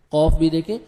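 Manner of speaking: ordinary talk, nothing marked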